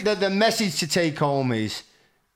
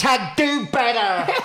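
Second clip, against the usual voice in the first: higher, slow, hammed-up preachy slogan; mock slogan; 7 semitones higher, slower than usual. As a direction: sneer the hashtag like a scold online. Mocking a social media slogan.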